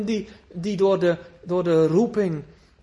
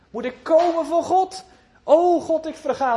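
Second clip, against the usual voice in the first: high, louder anguished plea; despairing cry; 8 semitones higher, 4 dB louder than usual. Acted. Preacher voicing a drowning sinner's anguished cry.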